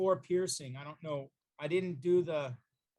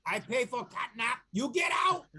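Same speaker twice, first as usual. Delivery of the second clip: broken-English cat rising to a loud, high demand; a bossy cat shooing the lady away; cat player telling Greta she wants her nap; cat character speech; 4 semitones higher, 3 dB louder than usual. Acted. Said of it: "Be like".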